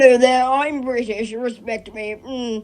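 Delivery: hammed-up stuck-up British gent; snooty British accent. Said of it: "stereotypical kind of grand British accent".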